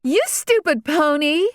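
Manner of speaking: high, shrill-pitched insult yelled; yelling at pony. A narrator's reading. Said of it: "Regina yelled".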